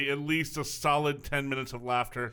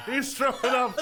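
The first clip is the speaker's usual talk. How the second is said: higher, words broken by laughter; after laughing hard; 7 semitones higher than usual. Real, not acted. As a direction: still laughing helplessly. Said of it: "I haven't laughed that hard".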